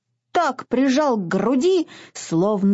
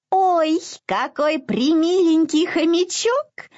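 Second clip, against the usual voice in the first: high squeaky mouse voice; squeaking; 7 semitones higher than usual. A narrator's reading. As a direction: squeak it out delightedly. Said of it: "пропищала она".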